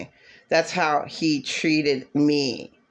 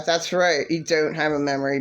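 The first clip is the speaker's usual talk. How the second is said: ordinary delivery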